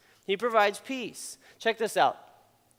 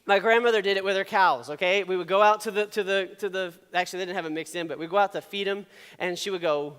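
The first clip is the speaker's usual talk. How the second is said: usual speaking voice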